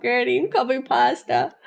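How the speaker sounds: ordinary delivery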